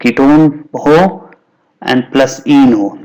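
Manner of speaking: slow, spaced-out word parts; slow breakdown. Shown as a demonstration. Teacher showing students how ketene is formed, slow and deliberate. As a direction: spell out the parts slowly, pausing between them.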